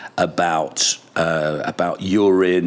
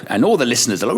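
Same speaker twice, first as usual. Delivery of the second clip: normal talking voice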